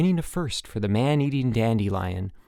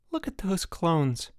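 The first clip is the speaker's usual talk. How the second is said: higher, slow wonder; marveling; 7 semitones higher, 3 dB quieter, slower than usual. A narrator's reading. Mike watching the counselors play tag, marveling. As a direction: draw it out in amazement. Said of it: "marveled Mike".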